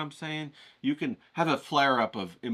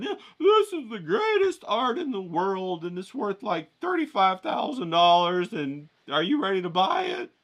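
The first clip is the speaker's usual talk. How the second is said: oversell the painting in a prissy high voice; snooty art dealer; 7 semitones higher, 3 dB louder than usual. Acted. Pushy art dealer pitching the painting to a buyer, high and pompous.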